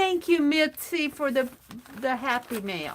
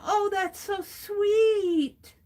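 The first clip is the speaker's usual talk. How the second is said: high, slow, tender gush; tender delight; 7 semitones higher, much slower than usual. Real, not acted. Host hearing a viewer's baby news, tender and slow. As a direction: melt over the news.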